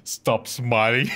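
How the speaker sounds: normal talking voice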